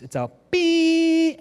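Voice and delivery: high, slow, held beep tone; imitated door beep. Preacher mimics the subway door beep, a high held tone. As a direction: sing it like the train's door chime. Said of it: "there's a bell that happens".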